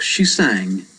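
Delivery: plain reading voice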